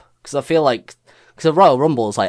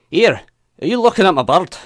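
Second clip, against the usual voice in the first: loud, higher-pitched Scottish challenge; Scottish accent; 7 semitones higher, 5 dB louder than usual. Acted. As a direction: pick a fight in broad Scottish. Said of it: "not that great at accents anymore".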